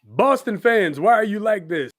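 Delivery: loud, high exasperated cry at the fans; exasperated plea. Pretend. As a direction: throw up your hands and plead with the fans. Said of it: "That's what I was trying to say".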